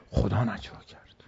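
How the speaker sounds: hushed whisper on God's words. Acted. Preacher voicing the Prophet's reply, in a whisper. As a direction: drop to a breathy whisper as if sharing a secret.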